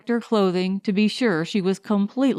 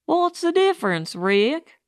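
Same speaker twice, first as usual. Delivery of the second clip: higher, shrugging resignation; philosophical; 7 semitones higher than usual. A narrator's reading. Fatso shrugs off Rick's complaint, philosophical and resigned. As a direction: let it go with a shrug in the voice. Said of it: "Fatso said, philosophically".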